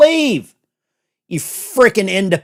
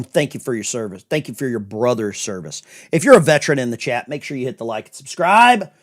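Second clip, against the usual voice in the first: ordinary delivery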